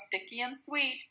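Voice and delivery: plain reading voice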